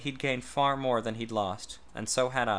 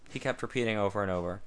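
plain reading voice

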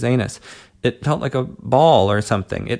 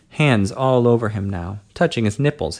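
usual speaking voice

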